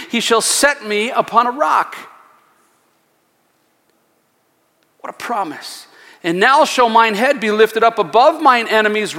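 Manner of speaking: solemn Bible reading, slowing at the end; scripture reading. Acted. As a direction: read the psalm as a proclamation. Reads scripture aloud.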